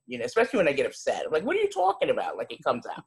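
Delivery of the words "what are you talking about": put-on New York accent. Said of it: "hear it in my accent ... when I get upset".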